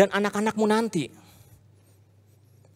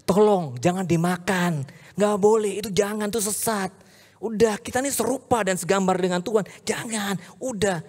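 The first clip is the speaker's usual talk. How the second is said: higher-pitched, acted plea to stop; pleading husband; 5 semitones higher than usual. Adam begging Hawa not to eat the fruit, pleading husband. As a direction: beg your partner not to do it. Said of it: "tapi dia nggak bilang gini".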